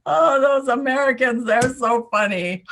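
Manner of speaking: loud mock amusement, winding down from laughter; laughing. Imagined Russian operatives mocking gullible Americans, laughing. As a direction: gloat as if recovering from a huge laugh. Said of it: "go home at night laughing".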